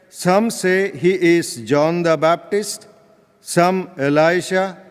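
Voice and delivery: usual speaking voice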